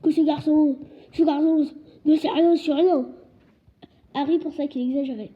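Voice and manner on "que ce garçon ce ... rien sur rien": loud, slow roar, words hammered out; furious giant roaring at the uncle. A narrator's reading. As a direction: keep roaring to the end, slowly. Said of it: "rugit Hagrid".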